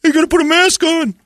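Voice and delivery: a prissy clerk scolding a customer; nagging store clerk. Retold or imitated. Store clerk demanding a customer put a mask on, high and fussy.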